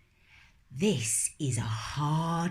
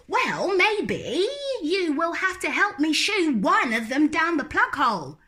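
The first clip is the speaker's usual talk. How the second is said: put-on high, stubborn little-girl voice, louder; little girl refusing; 10 semitones higher, 4 dB louder than usual. Lola refusing to go to bed, high and stubborn. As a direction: dig in like a stubborn small child.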